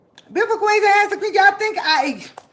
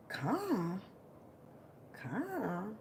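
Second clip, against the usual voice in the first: calm; 8 semitones lower, 20 dB quieter than usual. Shown as a demonstration. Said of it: "stay calm".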